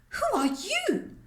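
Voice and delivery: put-on a scared girl facing a stranger; frightened. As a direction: gasp it out in fright. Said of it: "she asked in a frightened voice".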